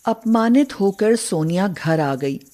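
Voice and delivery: plain reading voice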